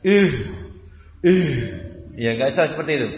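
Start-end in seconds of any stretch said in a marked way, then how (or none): 0.0-1.5 "Ih" said heavy, back of the tongue raised; wrong thick ha. Shown as a demonstration. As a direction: thicken the "Ih" as a mistake to avoid. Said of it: "Jangan dibaca dengan tebal".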